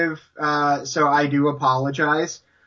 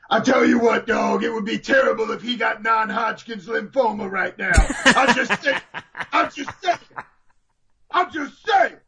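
over-the-top commentator rant, pitched up; Booker T; 4 semitones higher than usual. Acted. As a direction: rant with growing mock outrage, punching the catchphrase. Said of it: "come up with a new character for Booker T".

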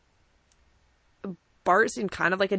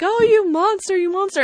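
high, wailing mock sob of a distraught wife; wife crying over her injured husband, high mock wail; 12 semitones higher, 5 dB louder than usual. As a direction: ham up a sobbing wife's accusation, much higher. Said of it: "crying over him and being like".

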